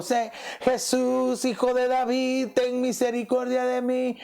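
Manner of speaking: high, drawn-out call to Jesus; crying out. Retold or imitated. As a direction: call out to be heard over the crowd. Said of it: "Y le gritó".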